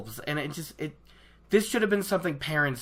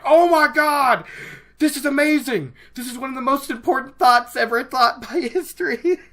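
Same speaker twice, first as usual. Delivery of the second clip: much higher, much louder, gushing with delight; a fan losing it over the book, shrieking with joy; over-the-moon excitement; 8 semitones higher, 7 dB louder than usual. Retold or imitated. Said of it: "you guys should have seen my face".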